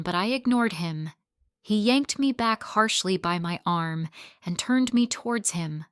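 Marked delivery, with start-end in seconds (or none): none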